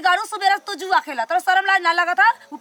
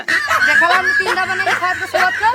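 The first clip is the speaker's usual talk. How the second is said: laughing shout of scorn; mocking laugh; 3 dB louder than usual. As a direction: laugh at him loudly. Wife mocks husband's boast.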